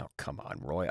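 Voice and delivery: breathy teasing whisper, almost no voice. Acted. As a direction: lean in and whisper it softly. Teasing aside to the manager.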